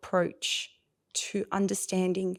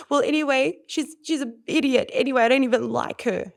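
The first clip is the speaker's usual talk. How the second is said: put-on angry teen; 5 semitones higher, 4 dB louder than usual. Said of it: "if she says to me".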